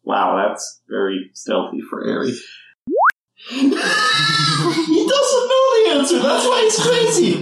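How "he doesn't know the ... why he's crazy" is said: high-pitched laughing speech; words squeezed out through laughter. Real, not acted. Laughing about his character.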